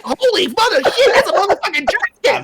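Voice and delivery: very high mock alarm; hammed-up squealed amazement, laughing; imitated shock. Player mimics the woman seeing the dragon, mock shock.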